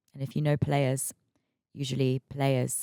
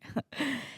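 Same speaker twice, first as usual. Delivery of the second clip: high laugh; 12 semitones higher, 6 dB quieter than usual. Real, not acted. Laugh after gossip intro.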